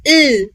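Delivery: ल held as a long "lll"; tongue tip on the upper teeth, hold ल; sustained, isolated ल sound; L letter sound. Shown as a demonstration. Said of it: "जबान की नोक को ऊपर वाले दांतों से टच कीजिए".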